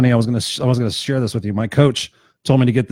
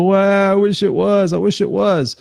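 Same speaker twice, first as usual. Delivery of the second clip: mock wistful regret; 6 semitones higher than usual. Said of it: "look at all this shit and go".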